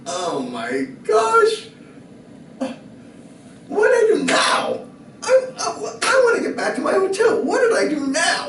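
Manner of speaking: high, loud panicked cry; frantic high-pitched panic at the sirens; panicked shout. Retold or imitated. Pulled over by highway patrol.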